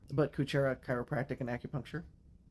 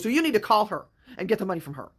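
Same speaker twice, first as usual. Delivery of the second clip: mock yell, louder and higher; retold yelling; 5 semitones higher, 7 dB louder than usual. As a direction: imitate a pushy, insistent demand for money. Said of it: "yelling at me about money".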